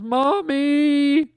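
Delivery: put-on childlike cry for mommy. Trapped astronaut calling out through the window, high wail. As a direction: cry out like a scared little kid. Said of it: "he just goes".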